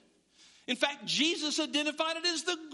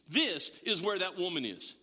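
ordinary talk, nothing marked

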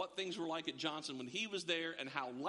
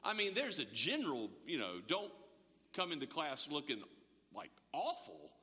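imitating the lady; 6 semitones lower than usual. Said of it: "and she goes".

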